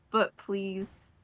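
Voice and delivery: ordinary talk, nothing marked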